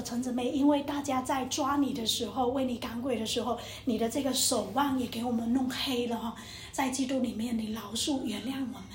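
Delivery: retold apology, subdued and low; humble apology. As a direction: ask forgiveness quietly and gently. Retold humble apology after refusing.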